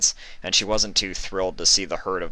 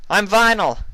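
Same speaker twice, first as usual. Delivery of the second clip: shouted over gunfire; 8 dB louder than usual. A narrator's reading.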